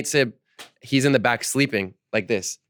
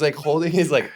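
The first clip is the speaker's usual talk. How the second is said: ordinary talk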